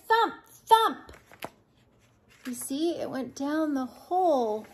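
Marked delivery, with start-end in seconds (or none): 0.1-1.1 high, punchy story sound effect; loud thumping sound word. Acted. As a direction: boom out each thump.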